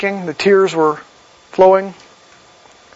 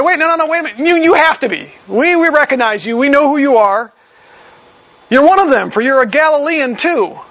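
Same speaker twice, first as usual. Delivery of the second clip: raised pitch, pressing and sure; a hint of a crowd accusing; insistent accusing crowd; 7 semitones higher than usual. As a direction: corner him with it, higher and urgent. Bystanders cornering Peter, accusing.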